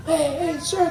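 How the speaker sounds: put-on very high, soft child voice; small high voice seeking attention; little boy. Little boys tugging at his shirt, soft high child voice.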